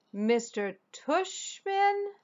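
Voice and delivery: incredulous repeat. Acted. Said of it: "I repeated".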